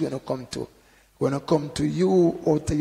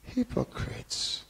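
slow, hushed and higher, mock-gentle; soft, mild reproach; 9 semitones higher, 8 dB quieter, much slower than usual. Shown as a demonstration. Preacher showing how Jesus wasn't saying it, soft and mild. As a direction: say it feebly, softly and slowly. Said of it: "he wasn't saying".

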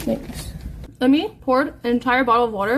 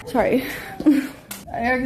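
normal talking voice

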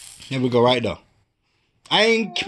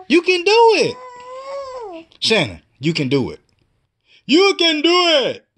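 cheering; 8 semitones higher, much slower than usual. Real, not acted. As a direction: cheer her on, stretching every word. Father cheering baby to crawl.

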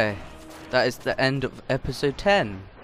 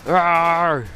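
a loud, high playful roar; angry dinosaur roar; 7 semitones higher, 10 dB louder than usual. Pretend. Streamer frustrated at missing the clip, mock dinosaur roar. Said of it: "I'm an angry dinosaur".

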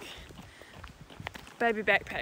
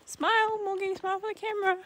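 high coaxing voice; 11 semitones higher, 4 dB louder than usual. Real, not acted. Coaxing toddler for photo.

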